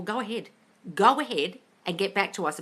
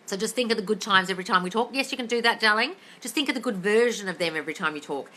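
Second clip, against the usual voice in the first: ordinary talk, nothing marked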